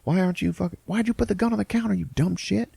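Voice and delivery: high, fast mock scolding; imitating an exasperated brother; hammed-up sibling rant; brothers arguing.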